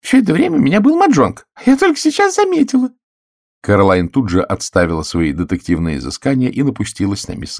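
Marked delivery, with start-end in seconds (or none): 0.0-2.9 very high, loud fluttery spinster; excited old lady voice; delighted old lady. A narrator's reading. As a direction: gush over the lucky hand. Miss Gannett voice.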